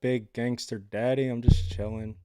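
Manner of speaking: ordinary talk, nothing marked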